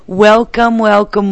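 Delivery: loud, high, bubbly welcome; excited greeting. Real, not acted. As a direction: call out the welcome with a big lift. Host greets listeners on air.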